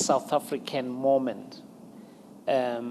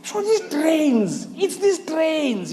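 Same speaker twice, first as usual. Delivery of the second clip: slow dawning realization; 11 semitones higher, 6 dB louder, slower than usual. Retold or imitated. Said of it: "So I was like".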